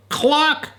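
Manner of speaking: high squeaky cluck; chicken cluck. Acted. Grandparent voicing the chicken Henrietta, a high cluck. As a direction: cluck like a hen. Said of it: "Henrietta said".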